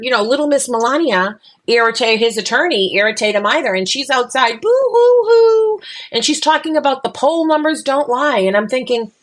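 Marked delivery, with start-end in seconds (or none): 4.6-5.8 high, slow, drawn-out fake boo-hoo; mock boo-hoo sobbing. Mocking Melania crying outside, hammed-up boo-hoo wail. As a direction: ham up a fake sob to mock her.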